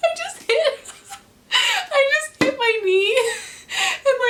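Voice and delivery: high, laughing through the pain; real giggly wince; high laughing explanation. Real, not acted. Laughing after knee hit.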